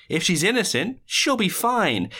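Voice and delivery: high-pitched mock reassurance, drawing out the end; lilting high, stretched-out promise. Acting out villain's reassurance.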